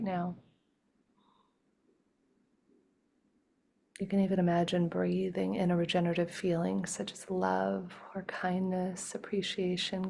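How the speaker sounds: gentle guided breathing voice; guided meditation. Acted. Leads a breathing exercise.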